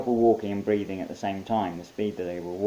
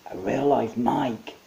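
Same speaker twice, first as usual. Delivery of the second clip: put-on slow, amazed young soldier; awed soldier; 4 semitones higher, 3 dB louder, slower than usual. Soldier marvelling at seeing Mike. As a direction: breathe it in wonder.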